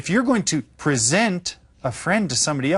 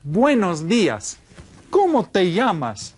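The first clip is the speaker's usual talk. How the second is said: model phrase; 6 semitones higher than usual. Shown as a demonstration. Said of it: "Repitan todos".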